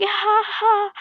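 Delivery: high, loud "ha ha" of amusement; mock child laugh. Kid laughing at the villain's funny name, imitated laugh.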